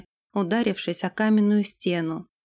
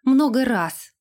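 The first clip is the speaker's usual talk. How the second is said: raised-pitch outburst; blurted out; 4 semitones higher, 4 dB louder, slower than usual. A narrator's reading. Irga adds to her confession to the lord, blurting. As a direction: throw it in as a blurt. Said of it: "выпалила она".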